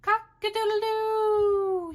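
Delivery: high, loud rooster crow. Acted. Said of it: "He can sound like a rooster".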